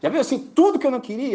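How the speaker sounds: high and loud, exasperated; exasperated outburst. Real, not acted. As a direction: burst out at the worst news. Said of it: "dread of being at the door".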